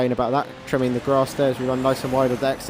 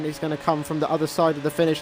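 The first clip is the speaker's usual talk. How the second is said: ordinary talk, nothing marked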